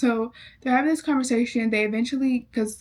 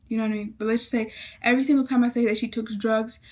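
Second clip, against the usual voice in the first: normal talking voice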